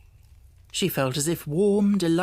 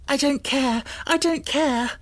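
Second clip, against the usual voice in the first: rapturous; 9 semitones higher than usual. A narrator's reading. Girl giddy in the dream room, high ecstatic cry. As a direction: fling it out, giddy.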